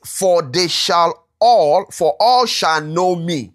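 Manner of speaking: slow, deliberate, leaning on each word; slow down to make them notice; emphatic reading. Shown as a demonstration. Preacher pointing the class to the key verse, slow and emphatic. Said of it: "now look at that bit".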